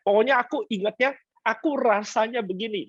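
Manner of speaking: lightly hammed-up wife voice; mock insistent wife. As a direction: imitate the wife stubbornly insisting. Pastor voicing wife's feelings.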